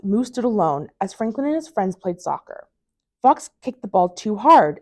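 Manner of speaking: ordinary delivery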